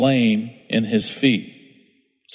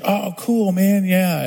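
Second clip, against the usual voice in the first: higher, sarcastic mock agreement; lightly voiced taunting enemy, higher; 7 semitones higher, slower than usual. Pastor mocks the enemy's sarcastic voice. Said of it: "the enemy the whole time is saying".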